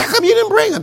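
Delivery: high-pitched acted demand; high mock interrogation; mock questioning. Questioning of officers, replayed.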